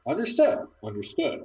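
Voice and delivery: rising high pitch. Acted. Trying voices for voice command.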